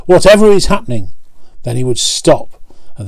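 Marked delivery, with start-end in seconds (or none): 0.1-1.1 startled. Acted. Truck startled by the bump.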